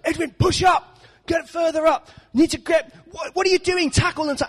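high-pitched hammed-up sideline shouting; dad on the touchline. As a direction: imitate an exasperated dad yelling instructions at his son. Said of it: "my dad would stand on the touchline".

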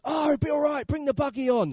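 high imitated tour-boat man; breezy boatman. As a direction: wave away the worry and hurry them aboard. Boatman waving away the buggy concern.

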